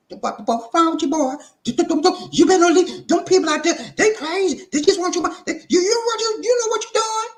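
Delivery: high falsetto, stammering in alarm; sputtering, scandalized aunt; frantic scolding auntie. Acted. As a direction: scold him in a panicked high voice. Auntie scolds nephew in acted scene.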